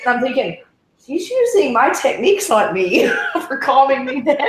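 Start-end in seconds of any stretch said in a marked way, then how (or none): 1.1-4.4 high amused thought, through laughter; laughing inner thought. Retold or imitated. Recalling the fan calming her, laughing.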